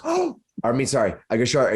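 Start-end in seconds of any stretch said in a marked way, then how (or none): 0.0-0.4 startled gasp. Acted. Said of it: "And Wave goes, gasps".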